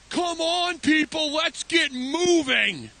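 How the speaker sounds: loud, high, drawn-out holler; impatient kid calling out. Retold or imitated. Child heckles slow shopper at checkout, loud impatient call. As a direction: imitate an exasperated child hurrying shoppers along.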